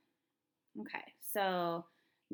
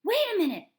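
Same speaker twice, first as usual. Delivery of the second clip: high, loud holler; 13 semitones higher, 14 dB louder, faster than usual. A narrator's reading. Girl stops the store manager, hollering. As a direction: holler out to stop them. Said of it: "I hollered".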